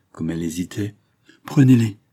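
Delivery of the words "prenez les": loud, raised-pitch urging; emphatic, louder insistence; insistent. Acted. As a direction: press the offer firmly. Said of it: "insista-t-il".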